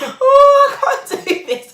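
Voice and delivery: very high, loud wail mixed with laughing; crying laugh. Retold or imitated. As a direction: sob it out half laughing. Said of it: "So I started crying".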